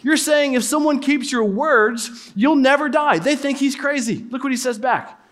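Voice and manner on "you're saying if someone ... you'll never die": higher, mocking disbelief, stretched out. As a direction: play the scoffing crowd, pitched up. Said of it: "They're like".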